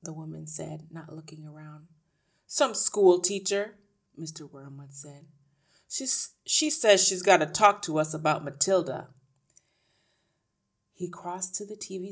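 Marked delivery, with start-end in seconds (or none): 2.5-3.8 loud, slow, scornful answer; dismissive husband. A narrator's reading. Husband tells wife who called, dismissive. 5.9-9.1 loud put-on ratty man; gruff husband. A narrator's reading. Husband explains the visitor to his wife, gruff.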